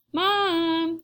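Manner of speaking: put-on high, drawn-out kid's call; calling for Mom. A narrator's reading. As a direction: call over your shoulder to your mother. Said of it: "she calls over her shoulder".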